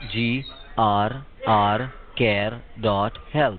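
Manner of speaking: slow, spelled-out dictation; dictating. Real, not acted. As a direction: spell it out letter by letter, slowly. Dictating a web address slowly.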